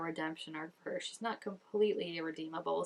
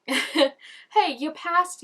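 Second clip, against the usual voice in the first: excited reminder; 8 semitones higher, 11 dB louder, much slower than usual. Real, not acted.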